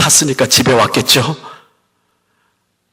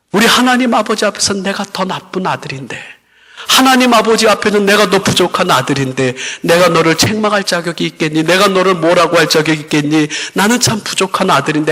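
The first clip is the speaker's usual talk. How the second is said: raised, ringing pulpit voice, pressing the point home; preaching; 6 semitones higher than usual. Acted. Pastor to congregation about the loving Father, preaching with fervor.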